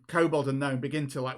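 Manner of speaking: ordinary talk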